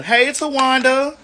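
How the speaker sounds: drawn-out sing-out greeting; playful calling out. Pretend. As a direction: call the name out slowly, stretching the end. Calling a nickname to viewers.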